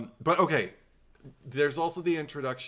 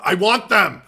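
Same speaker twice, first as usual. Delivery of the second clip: loud, much higher excited demand; urgent exclamation; 8 semitones higher, 13 dB louder than usual. Retold or imitated. Reading her own notes aloud to her co-host, loud and urgent. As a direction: blurt it out like a desperate fan. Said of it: "a lot of exclamation marks".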